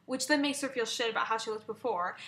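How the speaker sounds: normal talking voice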